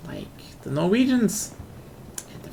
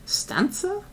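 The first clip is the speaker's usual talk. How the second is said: unsure guess; 12 semitones higher than usual. Real, not acted. Guessing the English word.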